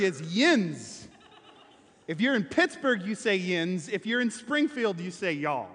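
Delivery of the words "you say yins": ordinary talk, nothing marked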